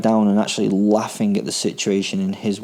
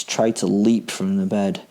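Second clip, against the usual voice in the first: ordinary delivery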